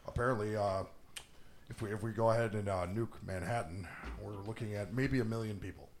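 lightly voiced low, soft official; underplay it, low and subdued; mock official estimate. Retelling a movie official's casualty prediction, mock-understated. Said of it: "They're like".

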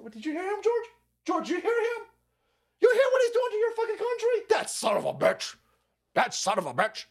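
high put-on voice calling to George; high outraged appeal. Mock citizen appealing to George, outraged and high.

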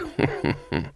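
soft, low, put-on chuckle. A narrator's reading. Mister Frank humours Granny, chuckling low. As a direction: chuckle under the breath in character.

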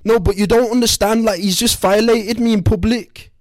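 high, loud, upset put-on Scouse voice; distraught wife. Wife begs husband for help, high distraught voice. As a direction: play the wounded wife, pitched high and loud. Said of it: "why are you Scouse".

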